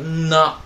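嗯呐 with a long hummed n before na; wrong nasal onset. Shown as a demonstration. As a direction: show the mistake in 嗯呐 by leaning on the nasal. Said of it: "不要读成嗯呐".

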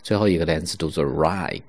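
normal talking voice

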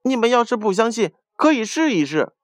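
high, quick, confident mouse; self-righteous. A narrator's reading. Mouse challenging the doubting animals, self-righteous. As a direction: put it to them boldly, high and fast. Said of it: "理直气壮地说".

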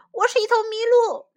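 high, loud shout; insistent shout. A narrator's reading. Moose insisting to the deer, shouting. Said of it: "莫里斯喊道".

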